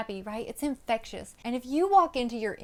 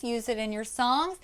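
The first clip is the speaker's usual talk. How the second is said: ordinary talk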